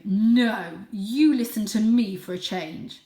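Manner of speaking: loud, defiant challenge; challenging father. Acted. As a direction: square up to the old cat.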